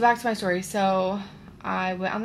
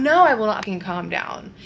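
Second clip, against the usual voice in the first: louder, indignant refusal; retold angry retort; 2 semitones higher, 6 dB louder than usual. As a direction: fire back defiantly, voice raised.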